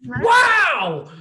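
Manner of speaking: high, loud exclamation; loud wow. Real, not acted.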